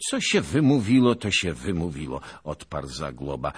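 pompous veteran holding forth at dawn; boastful old noble. A narrator's reading. Zagłoba boasting to his fellow knights, booming and pompous.

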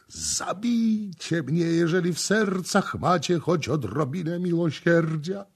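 high, anguished plea; wailing roar. A narrator's reading. Tortured voice from underground begging the priests, wailing. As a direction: wail it out like someone begging for death. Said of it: "odezwał się ryk ... jęczał głos".

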